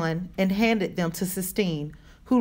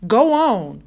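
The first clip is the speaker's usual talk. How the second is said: raised voice, coaxing an animal to move; firm urging; 4 semitones higher, 7 dB louder than usual. A narrator's reading. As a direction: send it off with a firm push. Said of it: "Rob said to him".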